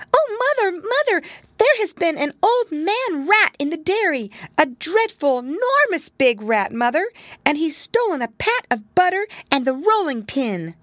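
very high, loud squeaky kitten voice; a tiny kitten tumbling out her story in terror; frightened little kitten. A narrator's reading. Frightened kitten tells her mother about the big rat, very high.

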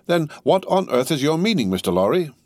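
a touch of wounded exasperation; crestfallen demand. A narrator's reading. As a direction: demand it with your pride dented. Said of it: "perceptibly crestfallen".

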